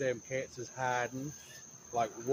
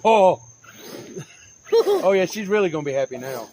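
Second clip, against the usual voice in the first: loud laughing; 4 semitones higher, 11 dB louder than usual. Real, not acted. Mower tears up the yard.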